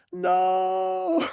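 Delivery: long, high drawn-out mock wail; hammed-up cry of refusal; mock dread. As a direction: stretch out a comic, horrified no. Retold dread at dog park invitation.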